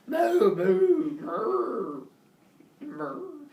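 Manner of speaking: high, loud moos dying away; distressed cow mooing. Acted. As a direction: moo like a doomed cow, fading. Cow being sacrificed moos.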